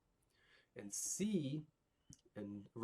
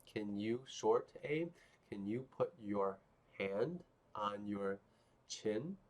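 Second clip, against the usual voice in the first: ordinary talk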